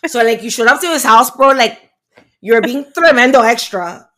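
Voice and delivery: put-on loud Kendall Miami accent; brash Miami girl voice. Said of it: "I'm doing a Miami accent".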